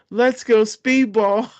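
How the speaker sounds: high, slow mocking rally cry; mocking call. Mocking the fighters at the show, slow high rally cry. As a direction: egg the boys on in a slow, high mocking chant. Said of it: "make fun of people-ish and said".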